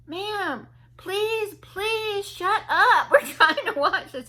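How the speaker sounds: plead, then drag out the outburst loudly; losing it. Retold or imitated. Young man begging a talker to stop, retold exasperated outburst. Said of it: "He just lost it".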